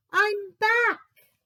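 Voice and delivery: high, loud announcement; bear is back. Acted. Bear announcing his return, high loud voice. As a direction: call out proudly.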